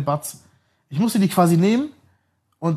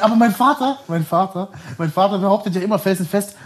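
ordinary talk

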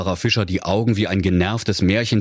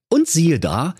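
plain reading voice

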